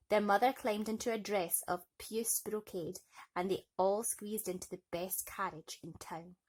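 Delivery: usual speaking voice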